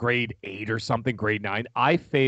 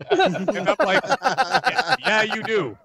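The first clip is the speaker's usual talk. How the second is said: laughing; 8 semitones higher, 4 dB louder, much slower than usual. Real, not acted. Teasing dad back.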